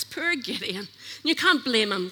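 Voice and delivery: normal talking voice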